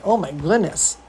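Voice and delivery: ordinary delivery, nothing marked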